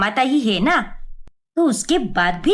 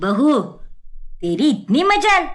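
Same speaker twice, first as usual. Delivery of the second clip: outraged scolding; 3 semitones higher than usual. Acted. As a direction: hit her with shocked fury. Mother-in-law outraged at bahu.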